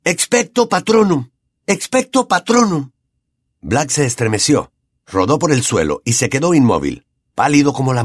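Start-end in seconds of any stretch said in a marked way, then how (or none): 0.0-2.9 high, forceful incantation; shouted spell. A narrator's reading. Harry casting against the dementors, shouting the spell. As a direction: hurl the spell out. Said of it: "a gritos".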